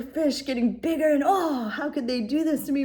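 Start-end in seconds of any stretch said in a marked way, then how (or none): 1.2-2.8 higher, whiny mock wail; mock your own wounded ego; hammed-up hurt whine; self-pitying whine. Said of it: "I could feel myself puffing up".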